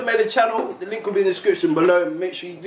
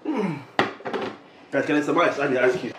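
normal talking voice

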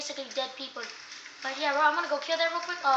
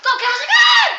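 much higher, louder rallying yell; cheering shout; 14 semitones higher, 15 dB louder, slower than usual. Real, not acted. Player cheers the candlestick on with a loud shout. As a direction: yell him into battle.

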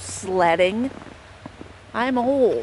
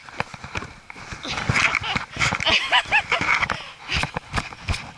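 giddy laugh. Real, not acted. After sledding run.

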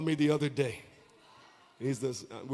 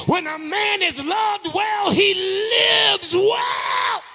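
rising gospel sermon, ending high and drawn out; full-force preaching; 8 semitones higher, 6 dB louder than usual. Real, not acted. Preacher blessing the congregation with love, building to a shout. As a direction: build line by line to a long shouted peak.

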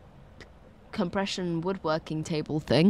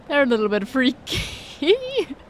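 laughing; 6 semitones higher than usual. Real, not acted. Laughing while calling creatures freaky.

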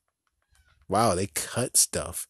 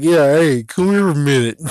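louder, calling someone over; beckoning call; 3 semitones higher, 9 dB louder than usual. Pretend. Grim Reaper summoning the Chucky voice actor, loud beckoning call. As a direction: call him over with a loud, eager hey. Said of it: "It's like".

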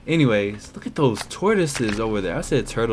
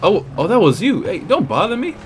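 normal talking voice

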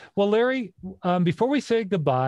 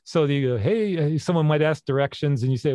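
normal talking voice

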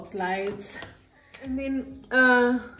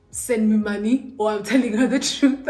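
louder, pushy blackmail demand; hammed-up threatening demand; mock threat; 8 dB louder than usual. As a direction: lean on him like a blackmailer. Friend's imagined blackmail demand.

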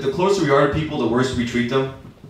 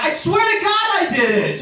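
pitched way up, desperate swearing; high pleading denial; slower than usual. Retold or imitated. Boyfriend pleading innocence to angry girlfriend, high squeak. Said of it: "I'm like".

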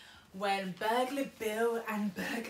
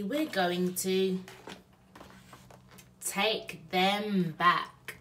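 very slow, weighty promise; Bill vows to return the stolen things, very slowly; resolute Bill; 4 dB louder, much slower than usual. Acted. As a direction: say each word like a vow.

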